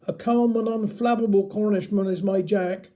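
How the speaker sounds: ordinary delivery, nothing marked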